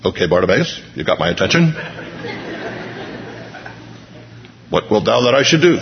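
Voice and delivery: mild no-nonsense transaction voice, speeding up at the end; businesslike tone. Retold or imitated. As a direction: lightly play a busy clerk handling a case. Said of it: "It's not a business tone".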